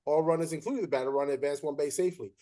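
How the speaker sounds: fast run through the rulebook line; rattled-off rule. Retold or imitated. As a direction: reel it off like you know it by heart.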